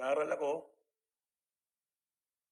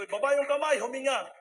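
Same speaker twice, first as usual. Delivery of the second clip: loud, higher preacher's command; commanding revival shout; 9 semitones higher, 9 dB louder than usual. Retold or imitated. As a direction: bellow it to the whole ward. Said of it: "sumisigaw ako".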